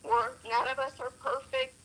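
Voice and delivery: ordinary delivery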